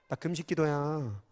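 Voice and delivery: 자랑하듯 낮고 느리게, 속삭이듯; a smug, soft, slow show-off boast. Retold or imitated. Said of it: "이렇게 알리고 싶어 하셔?". (금식을 자랑하는 사람 흉내, 느리고 조용하게)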